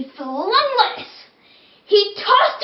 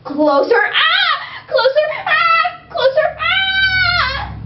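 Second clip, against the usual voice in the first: screaming tooth; 12 semitones higher, much slower than usual. Acted. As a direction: scream in terror as it comes closer. Tooth screams as carrot nears.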